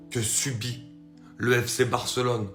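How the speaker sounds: normal talking voice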